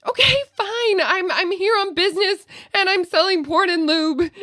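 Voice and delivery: exasperated confession. Retold or imitated. Finally confessing to the agent.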